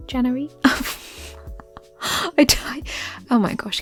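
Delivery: usual speaking voice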